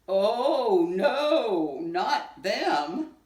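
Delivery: loud emphatic refusal; loud alarmed no, not them; rang out. Acted. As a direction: cry out no together. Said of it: "rang out at the same time".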